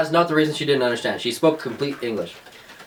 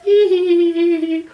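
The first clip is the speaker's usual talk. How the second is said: high, loud tittering hee hee; imitating the cashier's giggle; imitated giggle; 6 dB louder than usual. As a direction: giggle like the girl behind us. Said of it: "She was giggling the entire time".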